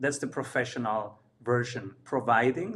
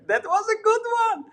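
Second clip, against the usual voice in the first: squeaky excited praise; 6 dB louder, faster than usual. Real, not acted.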